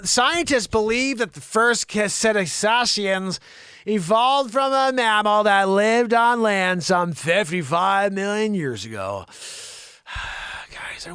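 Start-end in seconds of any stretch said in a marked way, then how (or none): 0.0-9.2 loud, slow announcer-style reading; news read. Acted. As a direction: project the story slowly, starting high and settling. Reads science story to audience.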